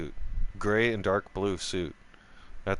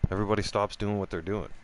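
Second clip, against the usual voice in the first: normal talking voice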